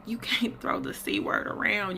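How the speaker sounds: hushed whisper; whispered retort. Retold or imitated. Retelling to viewers what she told the doctor, whispered. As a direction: whisper it like a forbidden word. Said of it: "But I'm like".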